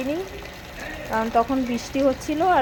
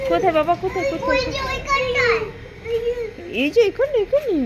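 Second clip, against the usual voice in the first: excited calling; 12 semitones higher, 4 dB louder than usual. Real, not acted. Mother calls to child about toy.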